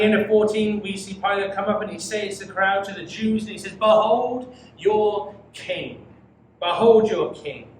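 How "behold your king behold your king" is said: slow, loud, mocking proclamation; sarcastic. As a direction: drag it out in scorn before the crowd. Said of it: "Pilate seems to be speaking with great sarcasm".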